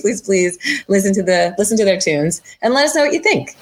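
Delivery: ordinary delivery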